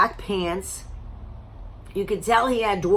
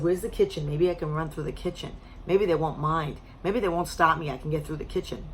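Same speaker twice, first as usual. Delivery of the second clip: quiet scheming; 4 semitones lower, 6 dB quieter than usual. Retold or imitated. Retold escape thoughts.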